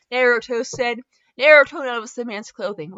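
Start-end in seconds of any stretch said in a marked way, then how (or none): none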